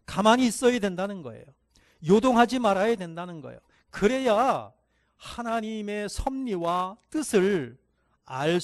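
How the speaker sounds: loud, driving sermon climax; fervent preaching. Acted. Pastor urges the congregation to stay still. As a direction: press the point home with rising force.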